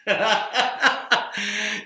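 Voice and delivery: long rolling laugh; amused burst of laughter; hearty laugh. Real, not acted. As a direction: laugh out after the silly race. Laughs after absurdly fast song.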